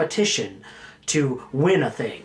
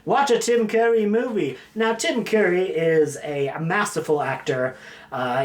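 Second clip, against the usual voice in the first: ordinary talk, nothing marked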